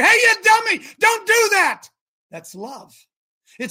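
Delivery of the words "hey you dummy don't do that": high, urgent warning shout; yelling. Acted. Preacher warning someone off an electric socket, yelling. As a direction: yell it to stop someone in danger. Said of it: "and I yell at you".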